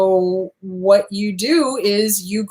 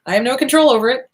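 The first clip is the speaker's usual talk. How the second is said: unbothered gray rock; 6 semitones higher, faster than usual. Retold or imitated. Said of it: "kinda gray rock, like".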